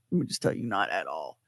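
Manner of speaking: a dry, muttered under-the-breath aside; deadpan low emphasis. Real, not acted. Telling the host, a low deadpan not at all.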